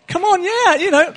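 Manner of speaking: high, loud triumphant cry; a preacher celebrating good news before the church; excited cheer. Real, not acted. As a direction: shout it out in joy, pitch way up and loud. Celebrating parents reunited.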